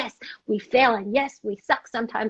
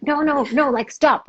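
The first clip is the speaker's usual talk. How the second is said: louder, urgent no no no; anxious parent yelling; 4 semitones higher, 6 dB louder than usual. Retold or imitated. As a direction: stop a child from touching. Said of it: "You're like".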